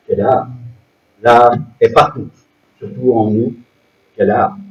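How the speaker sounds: low-pitched recital with a recurring refrain; poem recital. Acted. Reads a poem aloud.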